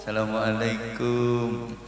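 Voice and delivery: soft, slow, deferential greeting; bow low with the voice, hushed and drawn out; humble student's salam. Shown as a demonstration. Santri greeting his kiai, humble soft slow salam. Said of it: "bungkuk-bungkuk".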